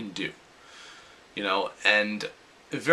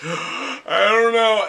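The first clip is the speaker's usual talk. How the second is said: mock scared; 10 semitones higher, 6 dB louder, much slower than usual. Scared atop a water slide.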